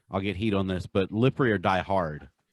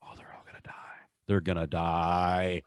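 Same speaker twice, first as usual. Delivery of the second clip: scared whisper; 3 semitones lower, 4 dB quieter than usual. Retold or imitated.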